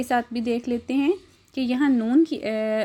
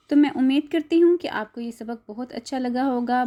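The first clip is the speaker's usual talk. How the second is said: ordinary talk, nothing marked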